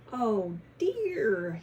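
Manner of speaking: a light gobbling turkey voice; mild gobble on oh dear. Acted. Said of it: "he gobbled".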